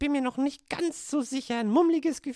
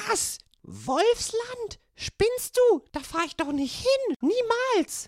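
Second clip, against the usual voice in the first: entsetzt und höher; alarmed refusal; 7 semitones higher, much slower than usual. Acted. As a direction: weigere dich panisch. Rotkäppchen entsetzt über Wolfsland. (panisches Nein)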